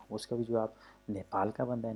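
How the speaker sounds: ordinary delivery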